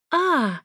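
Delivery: high "ah" moan; answering moan. A narrator's reading. Ex answers through the wall, high answering moan. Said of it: "почти мгновенно раздалось в ответ".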